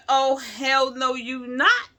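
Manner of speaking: loud, high, furious no; indignant loud refusal; retold angry refusal. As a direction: shout down the doctor's idea. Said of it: "I got mad. I was pissed".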